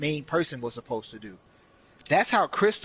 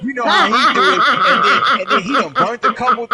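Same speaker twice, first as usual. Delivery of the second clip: hard laughter; 9 semitones higher, 13 dB louder than usual. Real, not acted. Laughing at frazzled Lorenzo.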